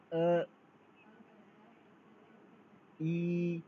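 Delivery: clearly sounded finals आ, वो, अ, ई, ऊ, यू, each drawn out and stressed; Chinese finals demo. Shown as a demonstration. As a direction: model each vowel आ, वो, अ, ई, ऊ, यू slowly for students. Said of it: "okay, फिर से".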